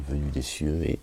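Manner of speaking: usual speaking voice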